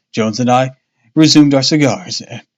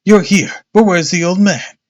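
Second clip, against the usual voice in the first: higher, excited exclaiming; a touch of startled excitement; exclaimed; 6 semitones higher, 3 dB louder than usual. Acted. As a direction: blurt it out in surprise. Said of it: "I exclaimed".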